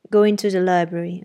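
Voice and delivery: the master ordering Jane to play, curt and commanding. Acted. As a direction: give it like a master used to obedience. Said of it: "Excuse my tone of command".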